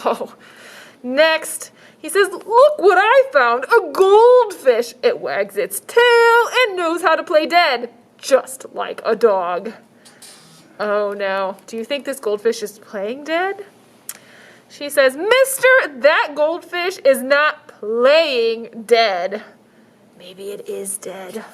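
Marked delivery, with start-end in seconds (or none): 2.3-9.7 high, slow salesman pitch; shelter man voice. Acted. Shelter man offering goldfish. 15.2-19.4 put-on high, slow, firm little girl.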